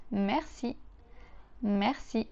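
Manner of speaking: merci with a French R, slowly; teacher's merci. Shown as a demonstration. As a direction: model merci for repeating. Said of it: "To say thank you, we say".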